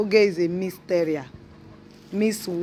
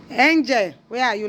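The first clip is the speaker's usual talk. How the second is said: high, loud call; 5 semitones higher, 5 dB louder than usual. Real, not acted. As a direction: call out for the pet. Calling out to the dog.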